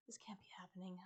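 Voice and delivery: hushed unvoiced whisper. A narrator's reading. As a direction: whisper it to yourself in disbelief. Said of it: "I tell myself".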